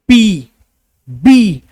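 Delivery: "पी, बी" punched loud with a burst; plosive demo. Shown as a demonstration. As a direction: pop "पी" and "बी" hard. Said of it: "लेकिन बोलिए". (ज़ोर से "पी, बी")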